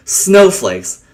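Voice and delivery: ordinary delivery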